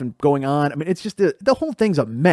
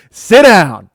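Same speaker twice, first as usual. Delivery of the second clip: loud, stern order; angry command; 3 semitones higher, 10 dB louder than usual. Retold or imitated. Furious mom ordering the suspended son to sit, loud. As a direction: bark the order at him.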